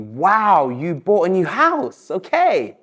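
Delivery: bright, interested wow, slow on the house; impressed wow. Shown as a demonstration. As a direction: sound genuinely impressed by the new house. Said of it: "So I could say to you".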